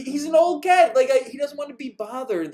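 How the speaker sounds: ordinary talk, nothing marked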